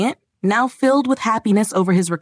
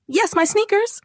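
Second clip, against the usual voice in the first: high-pitched excited outburst; overjoyed giant, voice leaping high; giant's joyful exclamation; 9 semitones higher than usual. A narrator's reading. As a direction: exclaim it with relieved joy, pitched way up. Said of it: "exclaimed the giant".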